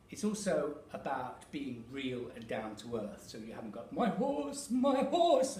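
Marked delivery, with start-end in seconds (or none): 3.9-5.6 much higher and louder, grand declaiming horse; old Victorian delivery. Shown as a demonstration. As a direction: ham it up like an old Victorian actor. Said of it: "as you may see in old Shakespeare, Victorian influenced deliveries".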